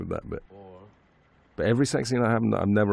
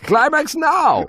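put-on very high, loud, slow Hungarian-accented yell; Hungarian director shouting; 11 dB louder, much slower than usual. Director yelling at actors on set, loud slow Hungarian shout. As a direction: bellow the cue in a Hungarian accent, dragging it out. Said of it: "in his Hungarian accent".

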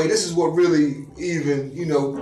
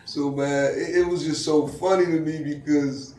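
usual speaking voice

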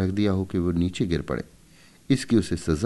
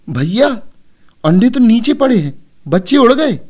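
put-on much higher, louder alarmed child's cry; loud call; 11 dB louder than usual. A narrator's reading. Shyama runs in to tell Keshav the eggs fell, loud alarm. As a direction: call out to your brother in alarm. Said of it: "जोर से बोली".

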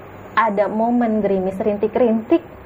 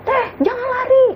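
desperate shout. Retold or imitated. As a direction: yell it twice, begging her to stop. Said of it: "katanya gitu".

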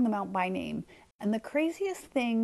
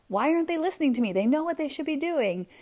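mildly exasperated, higher and quicker; mock inner grumble, pitched up; mad in the head; 6 semitones higher than usual. Leader whose team isn't listening, mildly mad. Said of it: "we just get mad in our head".

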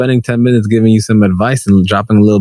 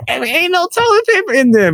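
squeaky complaining customer voice, pitched way up; high mock customer. Customer complaining to worker about no toilet paper, high whiny voice. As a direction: whine it like the annoyed shopper.

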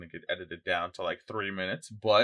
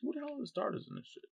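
pitched way up, exclaiming; excited high voice; 13 semitones higher than usual. Real, not acted. Gamer excitedly asking friend about the starters, high and eager. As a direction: rattle off the question in excitement.

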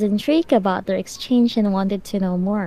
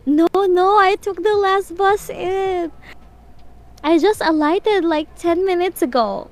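high, insistent protest; 9 semitones higher than usual. A narrator's reading. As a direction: plead your case, pitched up high. Protesting to suspicious men.

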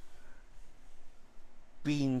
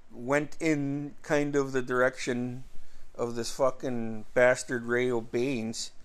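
normal talking voice

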